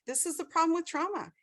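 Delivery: normal talking voice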